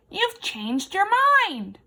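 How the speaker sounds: bright hopeful lift; hope the grasshopper stays. Acted. Effie hopefully greets the returning grasshopper. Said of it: "said Effie hopefully".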